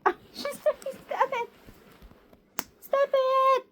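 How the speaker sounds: high, loud stop it; real protest. Real, not acted. Owner scolding biting bird, high and loud. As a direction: pull away from the bite.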